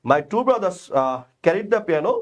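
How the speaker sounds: very slow, measured answer sentence; slow dictation. Shown as a demonstration. Teacher dictating the correct answer slowly. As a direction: give the answer slowly and clearly. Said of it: "কি বলছে ভাইয়া".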